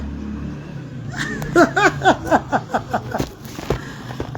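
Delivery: a long airy laugh with barely any voice; long breathy laugh. Real, not acted. Mother laughing at her shy son, long breathy laugh. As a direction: let a long soundless laugh run out. Amused by the child's refusal.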